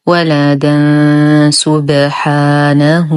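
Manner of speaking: ولدا سبحانه with a long nasal hum before س; ikhfa with ghunnah. Shown as a demonstration. Teacher modelling the join, nasal hum from ولدا into سبحانه. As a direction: chant slowly, hidden n held light at the end of ولدا. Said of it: "إخفاء حقيقي مع غنة كاملة مرققة".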